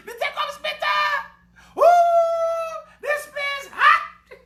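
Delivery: very high squealed shout; wild, high put-on voice; whooping comic character.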